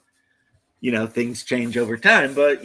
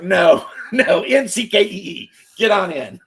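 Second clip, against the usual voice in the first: mocking official, laughing; 6 semitones higher, 4 dB louder than usual. Officials brushing off immigrant, laughing.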